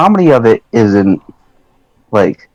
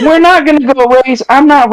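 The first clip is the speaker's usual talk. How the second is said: laughing protest; 13 semitones higher than usual. Real, not acted.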